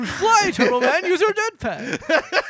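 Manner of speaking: mock kid voice. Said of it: "he's like".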